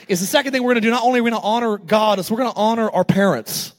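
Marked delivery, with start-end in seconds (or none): none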